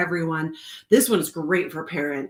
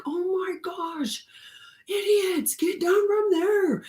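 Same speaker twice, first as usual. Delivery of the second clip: high-pitched, drawn-out exasperated call; slow, high cry of dismay, acted out; mock scolding; 13 semitones higher, slower than usual. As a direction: imitate calling out to climbing kids in alarm. Retelling alarm at climbing kids.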